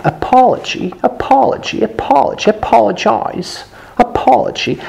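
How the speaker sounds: high-pitched British model with rounded "aw"; British-accent pronunciation demo. Shown as a demonstration. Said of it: "British variant would be closer to".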